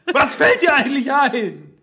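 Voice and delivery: hoch und laut empörte Stimme, lachend; empört. Pretend. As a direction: gespielte Empörung, viel höher. Empörter Nieser-Angreifer gespielt. (lachend gespielte Empörung)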